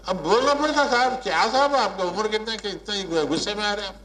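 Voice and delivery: mock exasperated teacher. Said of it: "retold complaining participant".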